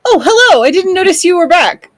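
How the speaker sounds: high, bright surprised hello; delighted high-pitched welcome; cheery in-character greeting. Acted. Character cheerily greets returning companions.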